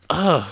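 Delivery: loud, high groan; exasperated groan. Real, not acted. Listing embarrassing things left behind, loud exasperated groan.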